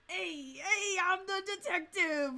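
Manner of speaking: loud, high Brooklyn detective; hammed-up Brooklyn cop announcing himself; Brooklyn accent. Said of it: "give him a Brooklyn accent".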